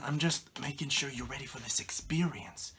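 whispered, breathy warning; ominous whisper. Acted. Dad whispering a warning to his son. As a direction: whisper it like a dark secret.

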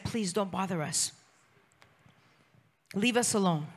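soft, subdued please don't bother us; quiet plea. Retold or imitated. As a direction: beg quietly, defeated. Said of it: "they came and they said".

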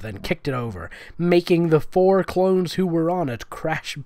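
usual speaking voice

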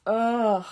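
loud exasperated groan; disgusted ugh. Acted. Rats groan at Wilbur.

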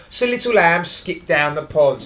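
plain reading voice

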